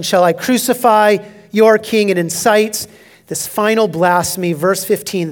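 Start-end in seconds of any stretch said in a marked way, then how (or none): 0.0-2.1 louder taunting question. Acted. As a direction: needle them with a taunt. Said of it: "It's a taunting question".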